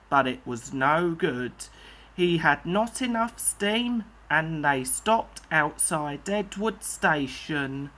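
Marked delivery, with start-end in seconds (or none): none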